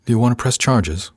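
plain reading voice

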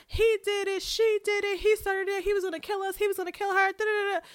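high, loud mock squabbling children; bickering kids. Recapper mimicking children blaming each other, high-pitched bickering. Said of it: "the kids all begin to bicker".

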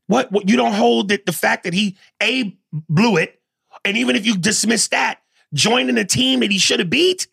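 high, heated comeback; incredulous objection. Real, not acted. Host challenging co-host who excuses the player, incredulous. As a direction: push back in high-pitched disbelief. Pushes back on co-host's defence.

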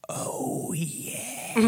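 soft, long-drawn ghost whisper; spooky, hushed EVP imitation; ghostly whisper. Voices option as the ghost.

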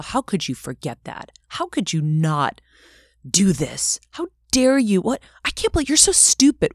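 building from cold scolding to a high, loud berating; harsh inner critic. Retold or imitated. Imagined scolding of a five-year-old self, growing to a high, loud rant. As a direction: lash out at yourself like a cruel inner voice. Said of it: "Would you say those things to that little girl?".

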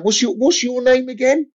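a boss who forgot him already; distracted boss. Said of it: "And he kept going".

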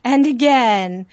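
slow, lingering romantic line; a hint of a smitten hero, drawn out; mock-dreamy lover's promise; swoony suitor. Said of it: "And he's like".

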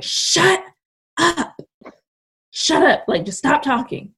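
high-pitched, broken-up retold snap; exasperated snap. Daughter in labor silencing mom, high exasperated snap. Snapping at mom during labour.

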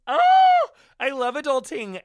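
high, loud squeal of mock delight; gushing squeal. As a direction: squeal it like the best news ever. Gushing mock delight over adulting.

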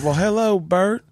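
high, lilting Southern greeting; Southern accent. Retold or imitated. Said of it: "have a Southern accent".

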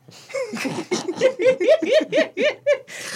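high burst of laughter; real laugh. Real, not acted. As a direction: laugh loud and high at yourself. Laughing at own spending.

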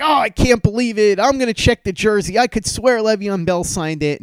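high, anxious disbelief; upset friend going to check the jersey. Retold or imitated.

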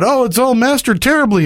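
high whiny band complaint; mock whining bandmate. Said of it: "they all bitch about".

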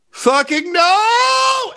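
high, loud, drawn-out scream. Acted. Consumed by a demon, heard through the air, screamed no. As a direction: scream it out, stretching the word. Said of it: "you just hear".

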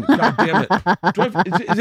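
high, laughing through the words; half-laughing, mock outrage at the jab; laughing exasperation. Real, not acted.

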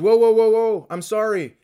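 high, loud whoa, backing off fast; panicked apology; startled apology. Retold or imitated. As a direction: back off fast from a drawn gun. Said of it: "And he goes".

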